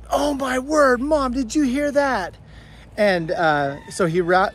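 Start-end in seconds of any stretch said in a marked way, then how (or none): 0.1-2.4 higher, excited mock kid voice; imitating her son. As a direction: play the shocked twelve-year-old calling his mom. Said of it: "my twelve-year-old, is like".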